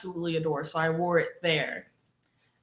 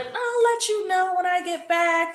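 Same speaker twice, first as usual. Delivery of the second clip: high, sing-out imitation voice; unhurried stepmom; 11 semitones higher than usual. Stepmom answering a message, imitated as blithely unhurried. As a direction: put on a high voice, in no rush. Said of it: "She's, like, taking her time".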